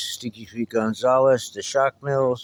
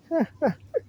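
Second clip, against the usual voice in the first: high laugh. Real, not acted. Laughs at the grandchildren's names.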